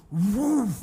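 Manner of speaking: loud, drawn-out "woof"; whoosh of descending power. Retold or imitated. Preacher acting out God's power descending, booming whoosh. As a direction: imitate a huge gust dropping suddenly.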